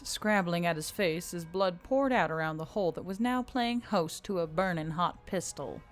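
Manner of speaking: ordinary delivery, nothing marked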